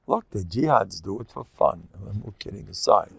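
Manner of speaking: ordinary talk, nothing marked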